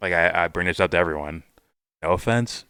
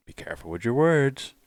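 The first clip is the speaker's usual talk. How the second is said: imitating a friend; 7 semitones higher than usual. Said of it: "you kinda tell me this sometimes".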